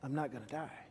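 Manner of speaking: soft, low reassurance to yourself; calming self-talk. Shown as a demonstration. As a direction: quietly tell yourself you're safe. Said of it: "And you gotta say".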